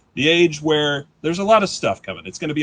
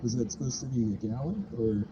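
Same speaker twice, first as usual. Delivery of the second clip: much softer puzzled mumble; confused baker; 3 semitones lower, 11 dB quieter than usual. Pretend. First baker over a ruined recipe, soft puzzled. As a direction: wonder it aloud, baffled.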